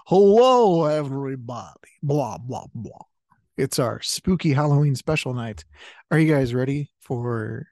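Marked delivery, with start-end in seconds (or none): 0.1-3.0 Transylvanian vampire greeting; Dracula voice. Pretend. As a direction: ham up the count's hello. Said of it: "should I have said".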